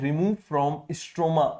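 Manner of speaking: extremely slow, drawn-out words; slow dictation. Shown as a demonstration. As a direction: dictate each word slowly. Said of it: "कहां से remove कर रहा है".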